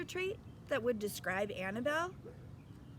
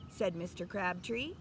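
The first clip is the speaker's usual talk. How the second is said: ordinary delivery, nothing marked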